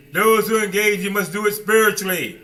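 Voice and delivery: ordinary delivery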